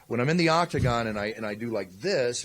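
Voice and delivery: ordinary talk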